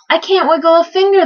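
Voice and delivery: high-pitched, helpless wail. A narrator's reading. Trumpeter with his arm stuck, wailing. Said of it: "the trumpeter wailed".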